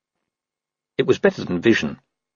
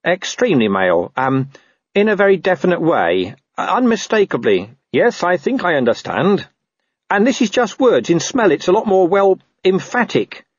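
high-pitched dog fumbling for delicate words; awkward talking dog; 9 semitones higher than usual. Acted. Dog tells Carrot about a male rival, awkward talking dog. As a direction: explain haltingly as the dog, pitched up. Gaspode awkwardly explains the wolf.

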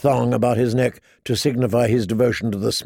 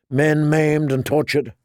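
usual speaking voice

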